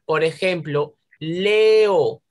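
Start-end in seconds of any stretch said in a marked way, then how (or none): 1.2-2.2 "Leo" loud and drawn out, le-o; teacher's emphatic split of "Leo"; separated vowels. Shown as a demonstration. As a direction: project "Leo" with a long E before the O. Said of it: "tenemos que separarlas".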